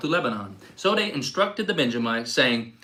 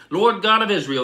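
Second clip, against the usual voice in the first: raised, higher plea to God; crying out; 6 semitones higher, 5 dB louder than usual. A narrator's reading. People weeping at Bethel cry out to God, louder and higher. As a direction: call it out to God in lament. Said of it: "they cried".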